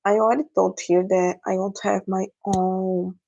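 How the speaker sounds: ordinary talk, nothing marked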